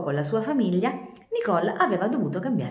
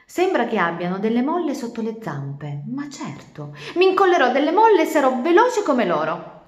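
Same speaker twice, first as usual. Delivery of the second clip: excited idea; 6 semitones higher, 6 dB louder than usual. Acted. Turtle's eager idea.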